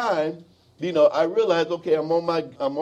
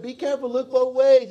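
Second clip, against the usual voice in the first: mom warning; 10 semitones higher than usual. Retold or imitated. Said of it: "the mom".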